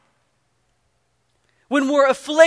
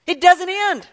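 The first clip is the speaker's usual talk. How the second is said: preaching emphasis; 8 semitones higher, 4 dB louder than usual. Acted. Climax of preaching.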